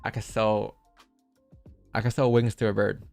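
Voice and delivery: normal talking voice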